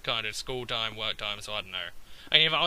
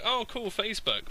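sarcastic; 10 semitones higher than usual. Retold or imitated. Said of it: "And I was like".

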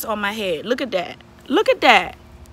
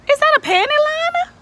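spot something gross and gasp it out; shocked question. Real, not acted. Vlogger finding a panty liner in the wig, shocked.

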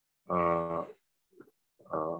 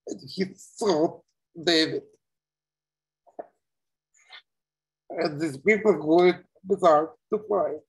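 voice breaking; 6 semitones higher than usual. Real, not acted. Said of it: "too emotionally difficult to talk about".

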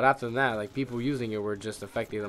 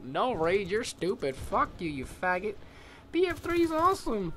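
high, whiny mock of an angry gamer; mocking angry fan; 11 semitones higher than usual. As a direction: squeal like a butthurt fan defending the game. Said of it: "I know you guys are gonna like".

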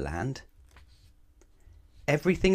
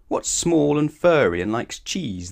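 slow, raised, louder riddle; clever questioning; 7 semitones higher, 5 dB louder, slower than usual. A narrator's reading. Clever elephant quizzing Mister Dizzy, cleverly slow and high. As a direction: pose it cleverly, drawing it out. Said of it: "the elephant asked cleverly".

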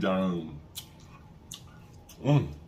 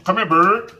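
coaxing a wild bird; 8 semitones higher, 7 dB louder than usual. Pretend. Calling the chicken piece.

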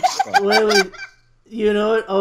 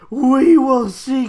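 slow, higher and louder comeback; confident; 5 semitones higher, 6 dB louder, slower than usual. Acted. Cloud answering Vincent's boast, a confident slow comeback. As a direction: answer the rival's boast coolly. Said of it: "Cloud says confidently back".

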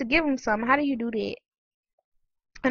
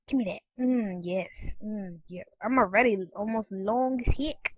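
ordinary talk, nothing marked

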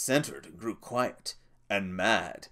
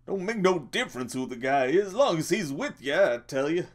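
crying out; 8 semitones higher, 4 dB louder than usual. Acted. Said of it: "he cried".

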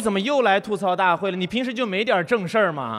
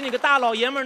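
ordinary delivery

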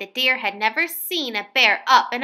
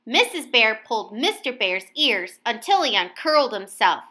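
usual speaking voice

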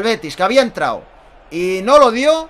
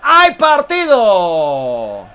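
stretched loud goal call; spanish goal commentary; 11 semitones higher, 11 dB louder, much slower than usual. Real, not acted. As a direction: belt it out, slow and drawn. Goal called.

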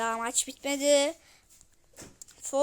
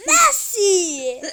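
laughing; 5 semitones higher, 9 dB louder than usual. Real, not acted. Laughing after the shout.

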